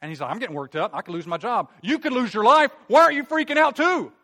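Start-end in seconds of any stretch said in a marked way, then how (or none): 0.3-1.7 agitated, voice starting to rise; acted-out mounting panic, louder toward the end; getting worked up. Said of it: "getting a little worked up here". 1.8-4.1 very high, very loud frantic yell; acted freak-out at full volume; worked-up outburst. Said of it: "getting a little worked up here".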